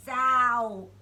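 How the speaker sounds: loud comic sound effect; loud zow. A narrator's reading. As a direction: punch the sound out.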